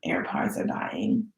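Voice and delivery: low unvoiced whisper, slow; whispered dying-battery lament. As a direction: breathe it out slowly like a sad aside. AirPods dying, annoyed.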